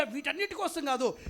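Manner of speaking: usual speaking voice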